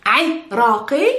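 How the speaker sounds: Iraqi split as "'i... raa-qee"; slow syllable demonstration. Shown as a demonstration. Teacher repeating Iraqi for learners, slow and split. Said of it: "you are called Iraqi".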